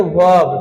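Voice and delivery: verb with silent R, like "vab"; correct pronunciation. Shown as a demonstration. Teacher models verb for the class, correct pronunciation. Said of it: "silent रखना है।".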